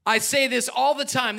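ordinary talk

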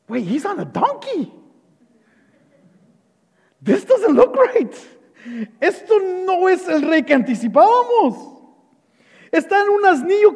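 high-pitched, incredulous objection; puzzled onlookers. Acted. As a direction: act out a bewildered crowd member. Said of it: "algunos de ellos tal vez se preguntaron". (espectador desconcertado, voz aguda)

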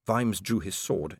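usual speaking voice

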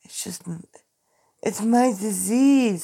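out of breath, halting; gasping between words; breathing hard. Real, not acted. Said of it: "Let me just take a few breaths".

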